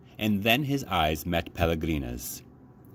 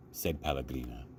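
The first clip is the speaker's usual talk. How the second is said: usual speaking voice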